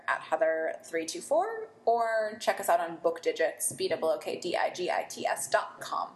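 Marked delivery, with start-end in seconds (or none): none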